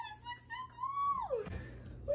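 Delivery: tiny, very high squeal, drawn out; awed squeal. Real, not acted. Painter watching paint run, soft awed squeal. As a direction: squeal softly and slowly, way up high.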